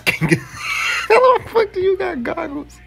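high, slow laughing question; laughing hard. Real, not acted. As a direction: laugh through the words. Laughing at friend's skin.

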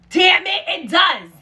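loud, high exasperation; high loud fed-up damn it; frustrated outburst. Real, not acted. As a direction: yell it in frustration. Angry at the failed attempt.

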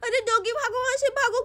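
ordinary talk, nothing marked

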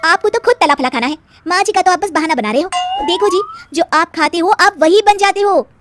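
wife lecturing husband about fried food, loud and preachy; preachy young wife. Acted. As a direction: scold him like a know-it-all fitness coach. Radha scolds husband.

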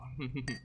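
short, light chuckle; little amused laugh. Real, not acted. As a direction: chuckle briefly to yourself. Amused after joking about boss.

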